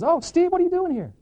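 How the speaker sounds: high-pitched, delighted-to-see-you surprise; high, startled hello; surprised greeting. Acted. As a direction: greet an unexpected visitor, pitch jumping up. Brother greets unexpected visitor in store.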